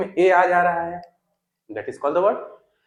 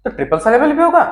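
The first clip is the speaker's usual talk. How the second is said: eager student; 8 semitones higher, 6 dB louder, faster than usual. Pretend.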